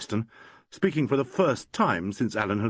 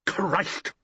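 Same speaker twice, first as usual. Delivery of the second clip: high savage curse; a stage manager losing patience; 10 semitones higher than usual. A narrator's reading. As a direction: spit the oath out. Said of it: "said Mason savagely".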